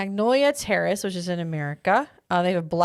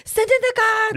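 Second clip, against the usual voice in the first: high, loud mock shriek; hammed-up German henchwoman shout; Frau's yell; 5 dB louder than usual. Joins in yelling Frau impression.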